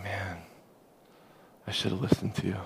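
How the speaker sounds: soft, low retold regret; rueful admission. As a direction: drop to a quiet, chastened aside.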